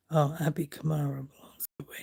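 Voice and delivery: fast whispered reading of a caption; puzzled whisper. Real, not acted. Whispering a confusing magazine caption to viewers, puzzled. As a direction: hush it out quickly, puzzled.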